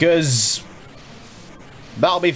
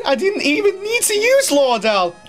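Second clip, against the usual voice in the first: very high, laughing while talking. Real, not acted. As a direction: squeal the words through laughter. Laughing through victory joy.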